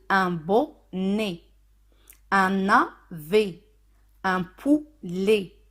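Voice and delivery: slow, drawn out syllable by syllable; slow example words. Shown as a demonstration. Teacher giving spelling examples to learners, slow and separated. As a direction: stretch each word for learners.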